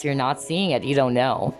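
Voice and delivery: normal talking voice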